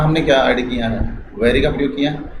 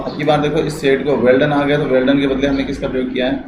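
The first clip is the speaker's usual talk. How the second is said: usual speaking voice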